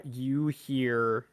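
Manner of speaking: ordinary talk, nothing marked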